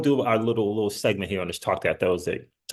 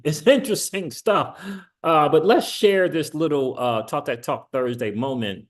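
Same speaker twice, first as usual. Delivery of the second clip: ordinary delivery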